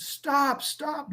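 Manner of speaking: high-pitched imitation of the wife's stop; wife pleading stop. Wife stops the angry father, high-pitched imitation. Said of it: "my wife comes".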